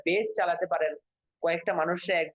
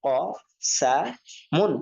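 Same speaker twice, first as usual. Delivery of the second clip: syllable by syllable, pauses between "ক", "সা", "মুন"; broken pronunciation; 3 dB louder, slower than usual. Shown as a demonstration. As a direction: sound out "ক ... সা ... মুন", each alone. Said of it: "এরপর আপনারা বাংলা উচ্চারণ করবেন".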